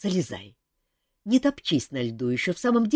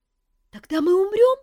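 high, louder worried squeak; anxious chick; 7 dB louder than usual. A narrator's reading. Chick asks if they will die, high anxious. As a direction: ask it alarmed.

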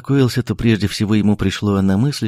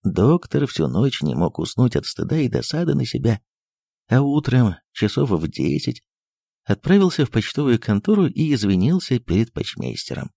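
ordinary delivery, nothing marked

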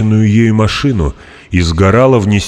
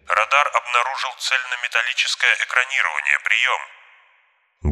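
over a pilot headset; 6 semitones higher, 8 dB quieter than usual. A narrator's reading. Radio report from the aircraft.

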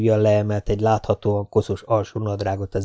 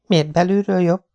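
higher, lighter voice for the heroine, curious; young woman's voice; 9 semitones higher than usual. A narrator's reading. Jessica asks Spider about his friend, higher curious voice. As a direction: play the young woman, a little doubtful.

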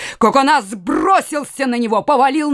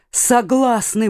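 plain reading voice

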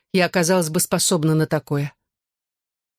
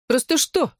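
high-pitched teasing question; 9 semitones higher than usual. A narrator's reading. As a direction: needle a friend with a high, lilting question. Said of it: "прикалывалась Клэр". (подкалывая подругу, высоким голосом)

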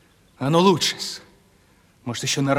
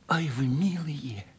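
шёпотом; 8 dB quieter, slower than usual. Acted. Мечтает о тройке.

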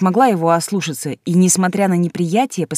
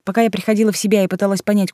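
usual speaking voice

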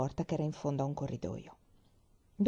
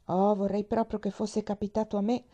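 ordinary delivery

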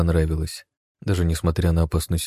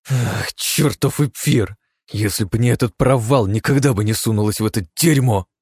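frustrated complaint; 5 semitones higher, 4 dB louder than usual. A narrator's reading. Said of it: "экспрессивный настрой".

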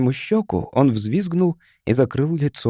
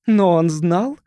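raised, insistent objection; objecting; 5 semitones higher, slower than usual. A narrator's reading. Mrs. Sharp arguing with the boy's mother, objecting. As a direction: counter her firmly. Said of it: "возразила миссис Шарп".

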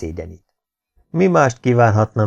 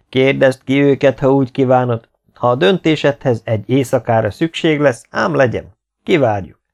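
loud, raised voice of a ruler; proclaiming king; 4 semitones higher, 5 dB louder than usual. A narrator's reading. King tells Nikolá to inspect the knights, loudly proclaiming. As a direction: address the whole court with authority.

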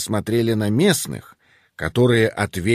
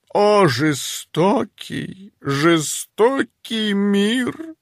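hammed-up tragic lament, high; overacting; 9 semitones higher, slower than usual. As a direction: melodramatic wounded mother, slow. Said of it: "Ты переигрываешь". (театрально, с наигранным надрывом)

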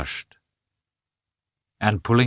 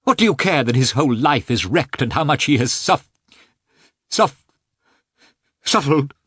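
high, loud and stumbling; flustered rush; 8 semitones higher, 5 dB louder than usual. A narrator's reading. Husband blundering over his words in agitation. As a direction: rush and trip over the word. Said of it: "speaking so rapidly that he blundered".

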